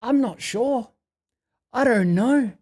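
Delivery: a light, simple "I'm not sure" example; calm non-answer. Shown as a demonstration. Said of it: "simply go".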